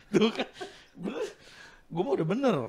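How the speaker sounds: high giggling laugh; can't keep a straight face; breaking character giggling. Real, not acted. Friend bursts out laughing.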